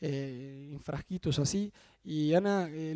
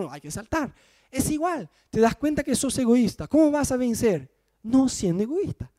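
ordinary talk

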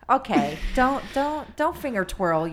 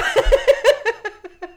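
high burst of laughter; loud laugh; 11 dB louder than usual. Real, not acted. Laughing after bit.

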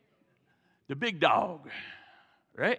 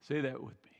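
whisper; 6 semitones lower, 6 dB quieter, faster than usual. Real, not acted. Preacher prompts congregation.

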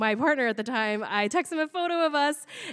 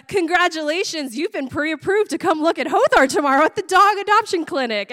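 higher, louder mock congratulatory email; excited announcement; 5 semitones higher, 6 dB louder than usual. Clinic email tells stoned applicant she's approved, excited announcement. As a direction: proclaim it like big prize news. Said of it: "I got a".

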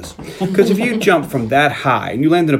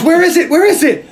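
high-pitched insistent questioning; mock urgent demand; 14 semitones higher, 4 dB louder than usual. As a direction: imitate someone frantically demanding an answer. Retold frantic search of man's leg.

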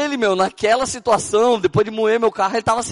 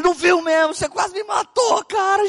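high excited shout; 11 semitones higher than usual. Retold or imitated. Scolding the man who almost killed him.

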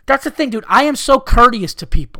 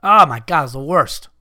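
usual speaking voice

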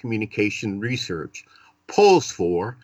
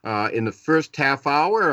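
ordinary talk, nothing marked

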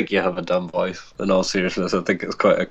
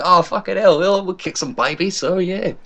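much higher, put-on Aussie voice; mock Australian accent; 11 semitones higher than usual. British friend teasing an Australian host, mock Australian accent. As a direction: imitate an Aussie with exaggerated vowels. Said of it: "you said it in an Australian accent".

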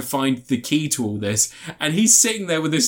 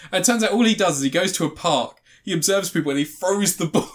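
ordinary talk

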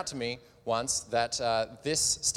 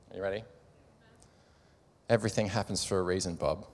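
mock soothing; 7 semitones lower, faster than usual. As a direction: soothe him with a hollow cliché, hammed-up. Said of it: "put his arms around the shoulder and says".

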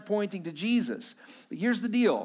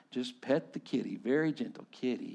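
put-on much softer and lower, coaxing the toddler; soft baby talk; 8 semitones lower, 7 dB quieter than usual. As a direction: coo it like parents soothing a little boy. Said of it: "And the parents go".

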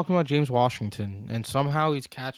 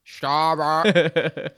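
drawn-out stop it, louder; 3 semitones higher, 5 dB louder than usual. Real, not acted. Repeated, escalating as partner hums.

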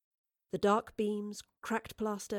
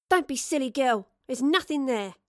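sharp, scolding mother; chiding; 4 semitones higher, 6 dB louder than usual. A narrator's reading. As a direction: scold the child impatiently. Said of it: "the mother chided".

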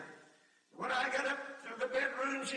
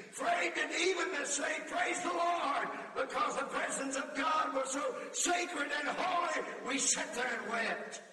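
preaching climax; 5 semitones higher than usual. Acted. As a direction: rise into a shouted preacher's peak.

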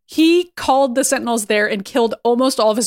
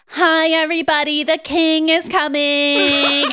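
lightly camp announcement, pitched up; mock herald; 5 semitones higher, slower than usual. Sinister in pigtails announces Apocalypse, mock herald. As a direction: sing out the arrival, stretching the last words.

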